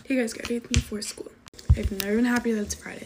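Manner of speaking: normal talking voice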